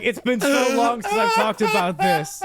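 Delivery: high voice squeezed by laughter; struggling to speak through laughs; laughing retelling. Retold or imitated. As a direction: quote it while cracking up. Retells friend while cracking up.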